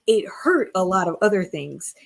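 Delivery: ordinary talk, nothing marked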